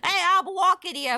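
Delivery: loud, high New York street yell; New York accent. Acted. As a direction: bark it like a brash New Yorker. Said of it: "not like the".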